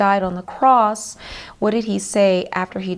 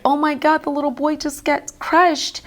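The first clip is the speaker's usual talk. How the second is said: high-pitched cry of dismay; re-enacted tearful distress; retold crying; 6 semitones higher than usual. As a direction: lament it as if upset, without sobbing. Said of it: "I remember crying like".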